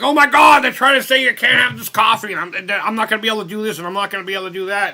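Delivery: high, loud, fast hammed-up panic; mocking panicked people. Streamer mocking outraged coffee drinkers in hammed-up high panic. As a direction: squeal it like a hysterical coffee drinker. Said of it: "They're all like".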